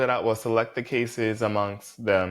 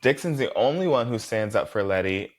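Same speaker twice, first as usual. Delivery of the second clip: ordinary talk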